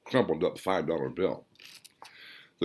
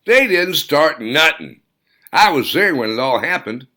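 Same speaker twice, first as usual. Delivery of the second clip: louder and higher, firmly insisting; heated protest; 6 semitones higher, 7 dB louder than usual. Acted. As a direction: push back hard, he was there. Charlie defends the couple heatedly.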